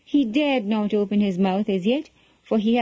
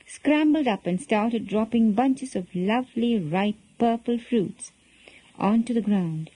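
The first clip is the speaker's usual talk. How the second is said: plain reading voice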